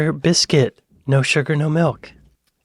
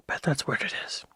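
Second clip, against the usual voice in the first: whisper; 2 semitones higher, 12 dB quieter than usual. Real, not acted. Aside while tasting.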